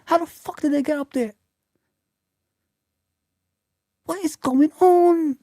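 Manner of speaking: high, loud, raging shout at the league table; furious rant. Real, not acted. Liverpool fan ranting to viewers, furious shouting. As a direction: yell in disbelief at the club's collapse.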